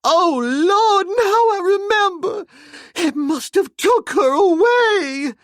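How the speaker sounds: very high, slow wailing cry; high slow sobbing wail; wailing maid. A narrator's reading. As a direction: wail in terror with eyes shut. Maid's voice held throughout.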